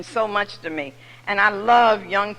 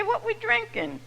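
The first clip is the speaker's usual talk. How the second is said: drinking buddies; 12 semitones higher than usual. Retold or imitated. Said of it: "You say".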